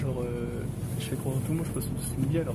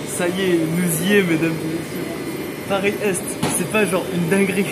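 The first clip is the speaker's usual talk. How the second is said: excited announcement; 2 semitones higher, 7 dB louder, slower than usual. Real, not acted. Arriving in Paris, announcing.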